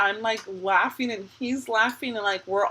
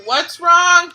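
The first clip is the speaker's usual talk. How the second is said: high, loud shout to another room; calling out; 9 semitones higher, 13 dB louder than usual. Real, not acted. Calls to crying child upstairs.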